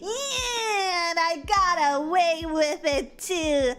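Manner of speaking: long, drawn-out victory cheer breaking into laughter; hammed-up celebratory yell, words stretched out; gleeful triumph. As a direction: crow about getting away with it. Gleeful celebration of being forgiven.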